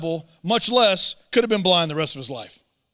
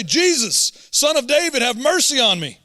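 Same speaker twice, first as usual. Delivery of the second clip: raised, pleading call, louder; crying out for help; 5 semitones higher, 5 dB louder than usual. A narrator's reading. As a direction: call out to Jesus desperately. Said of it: "he began to cry out and say".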